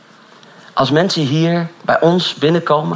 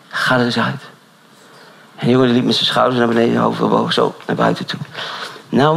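ordinary delivery

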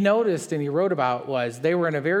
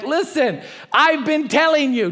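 much higher, louder smug scolding; hammed-up I-told-you-so Paul; petty told-you-so; 12 semitones higher, 7 dB louder than usual. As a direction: rub it in like a petty know-it-all. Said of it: "Paul's like".

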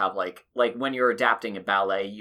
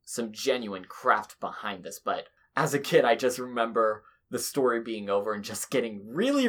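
usual speaking voice

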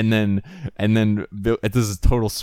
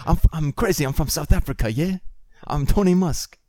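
high mock South African, rising "yeah"; South African accent; 8 semitones higher than usual. Trying out hero Tony Musk, South African accent. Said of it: "What's a South African last name?".

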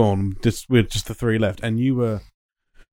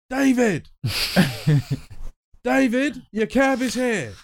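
much higher, mock call across the street; calling out a name; 9 semitones higher, slower than usual. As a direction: call David over like he's a stranger. Drunk friend calling taxi name.

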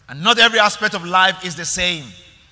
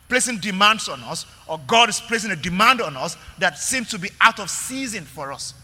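normal talking voice

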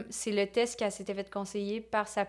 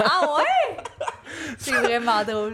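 laughing; 4 semitones higher, 4 dB louder, slower than usual. Real, not acted. Reacting with laughter.

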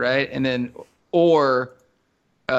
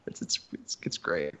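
whisper; 10 semitones lower, 11 dB quieter than usual. Real, not acted. Laughing aside, whispered.

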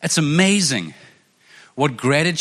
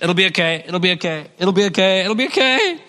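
mock self-reassurance; 3 semitones higher than usual. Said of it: "he's not talking about positive thinking".

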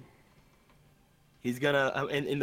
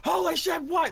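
startled high yell; shocked shout; 5 dB louder than usual. Retold or imitated. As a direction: blurt it out in shock. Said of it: "he wasn't gonna be like".

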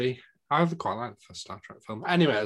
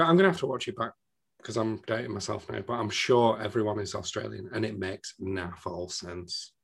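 ordinary talk

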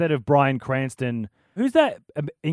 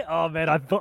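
ordinary delivery

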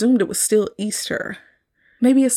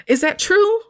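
high, louder rising question; incredulous; 11 semitones higher, 4 dB louder than usual. Real, not acted. Doubting the film's logic, high incredulous question. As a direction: squeak it out in disbelief.

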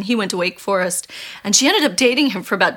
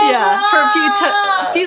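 laughing; 11 semitones higher, 4 dB louder than usual. Real, not acted. Laughing through imagined rock takeover.

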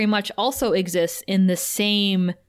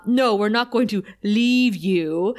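slow, put-on Dracula accent; mocking Dracula's accent; slower than usual. Xander mocks Dracula to his face, slow Dracula accent. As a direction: drag it out in a mock Dracula accent. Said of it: "starts mocking his accent".

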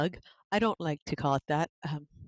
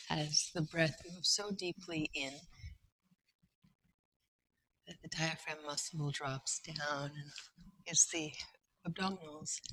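much softer, hushed breathing cue; soft guided breathing voice; 8 dB quieter than usual. Acted. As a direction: ease the voice down as the breath deepens. Guided breathing, voice drops right down.

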